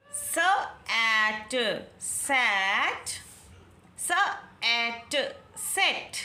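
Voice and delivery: very slow सैट then सेट; सैट open ae, सेट short e; slow phonics. Shown as a demonstration. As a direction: sound out each letter. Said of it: "किस तरह से हमने pronounce किया".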